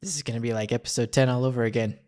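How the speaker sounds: ordinary delivery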